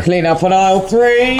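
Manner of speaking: announcer voice through a mic; shop tannoy announcement. Pretend. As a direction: call it out like a store tannoy. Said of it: "talking like a train announcer".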